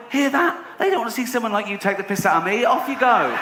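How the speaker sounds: high, gloating taunt at the heckler; mock taunting.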